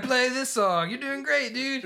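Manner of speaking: mock cheering fan. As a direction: cheer him on like an excited viewer. Imitating encouraging livestream viewers.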